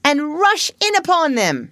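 high, emphatic rallying call; excited, mounting war talk; rousing battle cry. Acted. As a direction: urge the troops into the charge. Badger joins the rousing battle plan.

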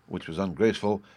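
ordinary delivery

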